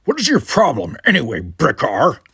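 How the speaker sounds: loud, confrontational question; demanding. A narrator's reading. Worf confronts Brickar, demanding. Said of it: "he demanded".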